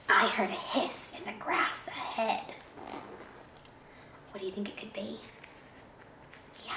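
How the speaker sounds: very soft, near-whispered storytelling; hushed suspense. Acted. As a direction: draw the children in with a secretive hush. Suspense: hiss heard, asides to children.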